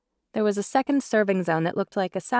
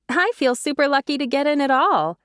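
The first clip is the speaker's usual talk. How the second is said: cheerful; 7 semitones higher, 4 dB louder than usual. A narrator's reading. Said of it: "with her usual cheerful smile".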